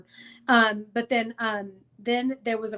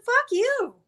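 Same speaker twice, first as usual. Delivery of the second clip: much higher, loud indignant snap; outraged retort; 4 dB louder, slower than usual. Retold or imitated. Wife retelling her outraged retort to her husband. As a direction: fire it back at him in disbelief.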